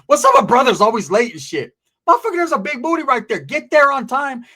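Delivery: loud, high-pitched mock complaint; exasperated rant. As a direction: rant loudly at a late friend. Acted rant at always-late friend.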